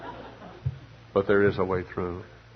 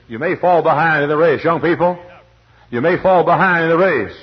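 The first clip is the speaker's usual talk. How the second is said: loud, raised preaching voice; fervent preaching; 7 semitones higher, 9 dB louder than usual. Acted. Preacher proclaiming to young people, loud and raised. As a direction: lift it and proclaim it.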